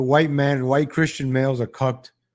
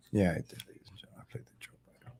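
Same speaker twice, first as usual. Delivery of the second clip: whispered aside, much softer; muttered aside; 9 semitones lower, 15 dB quieter, much faster than usual. Real, not acted. Host checking his own notes, whispered aside. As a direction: drop to a whisper to yourself.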